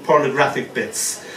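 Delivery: normal talking voice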